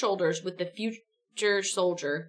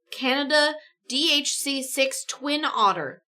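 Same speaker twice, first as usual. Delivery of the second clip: ordinary delivery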